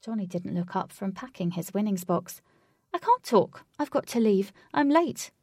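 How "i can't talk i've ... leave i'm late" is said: rushed dismissive bookie, louder and higher; hurried bookie. A narrator's reading. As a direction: brush him off, you're late. Bookie rushing, brushing Alec off.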